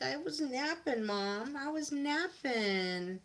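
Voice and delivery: high drawn-out dog's complaint; voiced dog. Dog to its mom about being woken, high whiny pet voice. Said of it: "She's like".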